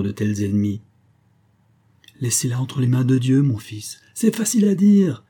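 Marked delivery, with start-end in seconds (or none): 4.1-5.2 high, bursting exasperation; exasperated retort. A narrator's reading. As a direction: snap back with pitch leaping up. Bitter retort to the priest. (riposte exaspérée, très aiguë)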